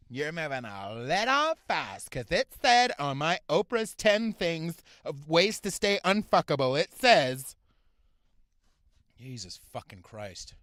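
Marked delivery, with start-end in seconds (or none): 0.1-7.5 loud, slow, exaggerated mock objection; mocking woman's voice. Scene: comic mimics his date turning him down, mocking voice. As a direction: drag it out loudly in mockery. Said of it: "Say, like".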